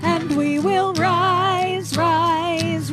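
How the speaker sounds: ordinary delivery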